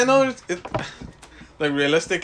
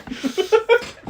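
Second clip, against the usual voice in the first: high, squealing laugh; 3 dB louder than usual. Real, not acted. Fan laughs at Sasuke's logic with a high squeal.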